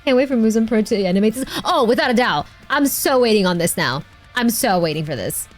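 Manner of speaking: ordinary talk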